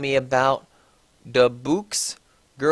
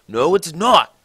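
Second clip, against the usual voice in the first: louder angry denial; shouted angrily; 3 semitones higher, 6 dB louder than usual. A narrator's reading. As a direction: snap back louder. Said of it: "I shouted angrily".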